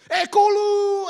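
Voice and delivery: long, high referee's yell; starting shout. Acted. Referee starting a sled race, drawn-out shout. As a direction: holler the final count to launch the race.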